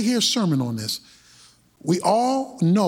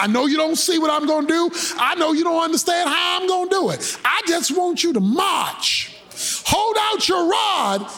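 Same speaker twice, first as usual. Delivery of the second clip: higher, shouted urging from God; God urging Moses; 9 semitones higher than usual. Acted. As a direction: push him to trust and march.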